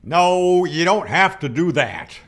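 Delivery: usual speaking voice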